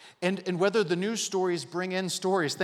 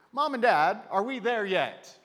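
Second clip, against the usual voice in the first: lightly voiced pestering kid, loud and higher; kid's car-trip question; 4 semitones higher, 5 dB louder, slower than usual. Kid asking parents from the back on a trip, loud mock pestering. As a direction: imitate a child calling out, drawn-out words.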